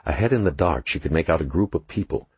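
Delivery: ordinary delivery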